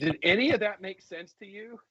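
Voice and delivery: laughing while asking, voice lifted; half laugh the question; laughing quote. Retold or imitated. Son asking his wife, laughing. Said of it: "he says".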